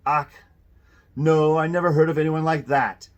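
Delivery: loud butcher with a put-on German accent; heavy German accent, loud. A narrator's reading. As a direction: deny it in a hearty German accent. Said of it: "a heavy German accent".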